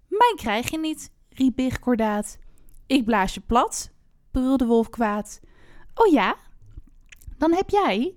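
expressive rhymed fairy-tale reading; story narration. A narrator's reading. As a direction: read it out like a storyteller, phrase by phrase. Reads a rhymed fairy tale aloud. (sprookje voorlezen, verhalend)

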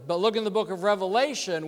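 normal talking voice

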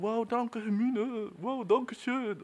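high, gushing mock gratitude; gushing thanks. Viewer gushing thanks to Hermine, high and gushing. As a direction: gush like a starstruck fan. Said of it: "man ist so".

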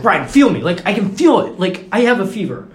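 loud, high mock protest; insistent roommate. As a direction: imitate someone loudly insisting he's ill.

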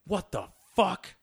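high, slow, drawn-out what the fuck; the chef halting mid-service in shock; retold angry outburst. Chef stops service, confronts diners.

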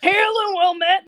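hearty hail. Acted. Barkeep hails the goliath.

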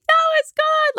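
sudden high squeak of doubt; squeaky unsure self-talk. Retold or imitated. Host mocking her own doubt after a live training, squeaky high voice. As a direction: squeak it out like a nervous kid.

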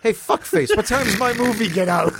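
called high across the line; cheery call out. Retold or imitated.

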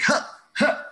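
high breathy excited huffs. Retold or imitated. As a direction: pant like a delighted child. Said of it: "He's like".

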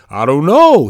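normal talking voice

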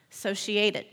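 ordinary delivery, nothing marked

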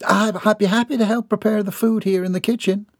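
volunteer a bit too keenly; eager young maid. Acted.

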